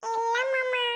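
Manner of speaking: put-on high little child voice; reply in a tiny child's voice; small child. Child telling uncle they have eaten, small child voice.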